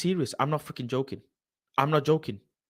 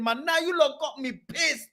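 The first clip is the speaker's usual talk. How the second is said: ordinary talk, nothing marked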